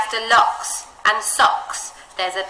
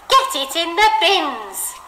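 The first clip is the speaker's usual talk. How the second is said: high, firm, slow bossy order; 8 semitones higher, 5 dB louder, slower than usual. Shown as a demonstration. Teacher showing the man ordering the lady, bossy. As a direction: boss her into the bins. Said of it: "he's going to be a little bit bossy about it".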